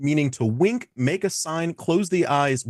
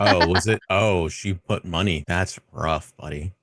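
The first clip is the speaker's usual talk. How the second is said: low quoted voice; 7 semitones lower than usual. Acted. Quoting a known reference line.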